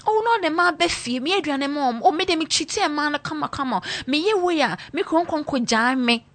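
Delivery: high, pleading wife voice; mock long-suffering wife. Wife in videos boasting of enduring her man, high mock plea. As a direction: play the devoted wife, pitched high. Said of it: "the woman says".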